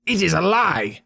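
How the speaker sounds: high outraged denial; furious shout. A narrator's reading. Trainer shouts at Holmes's whispered accusation, high and furious. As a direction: explode at the accusation. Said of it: "he shouted".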